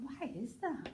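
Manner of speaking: softly wondering aloud; puzzled daydreamer. Acted. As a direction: lift it into a soft, dim question. Director voicing a puzzled character.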